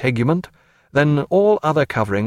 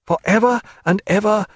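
higher, heartfelt farewell; tender young woman; 8 semitones higher than usual. Acted. Sophia bids Jack farewell, high and heartfelt. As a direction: promise it forever, higher.

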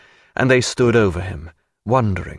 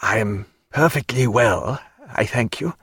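weak, dry croak; strained, parched voice; low croak. A narrator's reading. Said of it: "in a low croak he said".

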